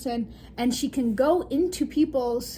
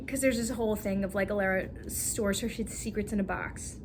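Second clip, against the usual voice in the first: ordinary delivery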